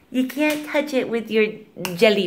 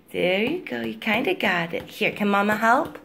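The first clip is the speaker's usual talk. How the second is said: ordinary talk, nothing marked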